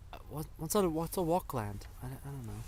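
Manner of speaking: quiet confused stumble over a name; teleprompter stumble. Pretend. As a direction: trail off, unsure of the word. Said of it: "that's what the teleprompter says".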